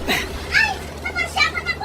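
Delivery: high shocked yelp at being splashed; startled cry. Acted. Splashed woman cries out in shock. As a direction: shriek as the muddy water hits.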